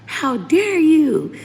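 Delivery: high, slow tearful indignant cry; hammed-up outrage at her cousin; indignant outburst. Retelling crying at cousin who blamed her, outraged wail. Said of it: "I cussed them out".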